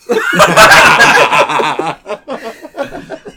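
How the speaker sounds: loud laugh held on and on; long burst of laughter. Real, not acted. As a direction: lose it completely. Group laughs at poem.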